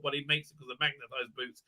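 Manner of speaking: ordinary delivery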